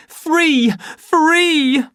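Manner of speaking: high, loud cry of freedom; triumphant shouts of free; shouting. Acted. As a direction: fling the word out joyfully. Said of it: "the savage shouted".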